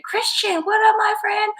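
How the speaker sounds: very high, playful squeaky hello; squeaky put-on call-out; high-pitched greeting. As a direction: greet a friend in a silly high voice. Calls out greeting to arriving viewer.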